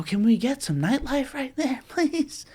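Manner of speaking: high, begging plea; pleading. Real, not acted. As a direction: beg for it with a laugh.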